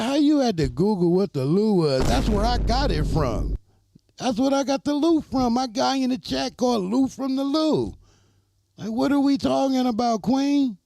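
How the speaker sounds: high, loud laughing protest; half-laughing disbelief; amused outrage. Real, not acted.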